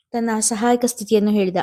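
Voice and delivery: usual speaking voice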